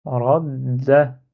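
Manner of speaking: RADDA with a long held d; shadda, doubled d. Shown as a demonstration. Teacher repeating the word with shadda, lengthened d. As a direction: linger on the dd of "Radda", then open to a. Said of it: "j'ai shadda, du coup, ça donne".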